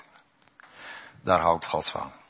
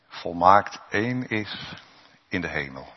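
ordinary delivery

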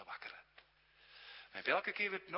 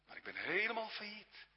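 anxious protest; 8 semitones higher than usual. Acted. Sinner protests to Jesus.

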